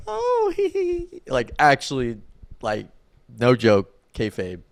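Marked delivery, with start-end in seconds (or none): 0.1-1.2 put-on high-pitched girly giggle, swooning; swooning girl giggle. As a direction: giggle like a fangirl swooning over him. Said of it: "he's got girls being like".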